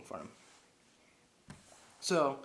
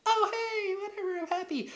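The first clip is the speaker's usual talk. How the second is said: very high, loud falsetto squeal; hammed-up girlish glee; mock delighted woman; 5 dB louder than usual. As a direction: imitate her overjoyed in falsetto.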